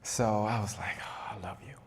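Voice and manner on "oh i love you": soft, breath-only mock surrender; grudging whisper. Crying boy giving in to his uncle, imitated grudging whisper. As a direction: let it out reluctantly under the breath. Beaten child gives in, retold.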